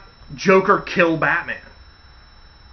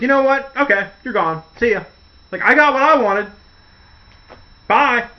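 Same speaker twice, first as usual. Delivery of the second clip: gloating glee; 7 semitones higher, 5 dB louder than usual. Retold or imitated. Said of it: "He'd be so much on top of the world".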